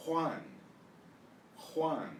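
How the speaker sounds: "Juan" as KHWAHN, with a raspy J; Spanish pronunciation. Shown as a demonstration. Teacher modelling the name for students, "Juan" as KHWAHN. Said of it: "Can you hear the difference?".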